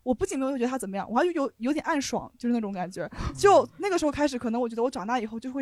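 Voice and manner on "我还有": ordinary talk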